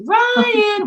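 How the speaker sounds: long, very high call of a name. Retold or imitated. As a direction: stretch the name out as an imitated call. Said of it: "And we hear".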